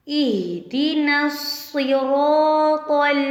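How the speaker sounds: slow, drawn-out recitation of इहदिना. Shown as a demonstration. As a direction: chant इहदिना slowly. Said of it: "सूरतुल फातिहा की आयत number पाँच".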